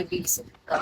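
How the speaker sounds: breathy giggling aside; a whispered, giggled jab; laughing whisper. Real, not acted. Laughing aside after denial.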